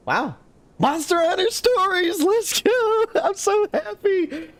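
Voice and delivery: very high, thrilled squeal; real elation. Real, not acted. Streamer opening a birthday gift game, overjoyed. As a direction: squeal with delight, laughing.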